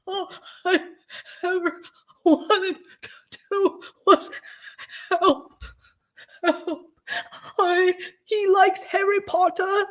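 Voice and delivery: high, laughing fake-cry; mock sobbing whine. Mock-crying streamer whining about only wanting to help. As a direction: whimper it like a hammed-up crybaby. Mocking his sobbing self-pity.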